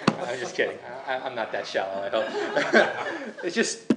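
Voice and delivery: said through a laugh; amused, laughter breaking into the words. Real, not acted. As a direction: chuckle while taking back the joke. Joking about his own pickiness.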